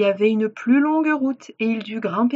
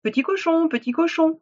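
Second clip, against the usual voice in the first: high-pitched coaxing wolf call; 7 semitones higher than usual. Acted. Wolf asking to be let in, high coaxing call. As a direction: coax the pig high to open up. Wolf calls at third pig's door.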